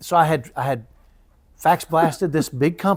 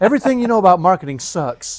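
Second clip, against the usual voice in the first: proud loud title; 5 semitones higher, 7 dB louder than usual. Retold or imitated. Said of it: "That was what I called it".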